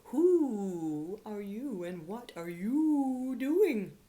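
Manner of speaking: slow, loud, drawn-out hooting owl voice. Acted. Owl calling down to Teddy, slow loud hoot. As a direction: hoot it down from the branch like a big owl. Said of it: "a voice hooted down".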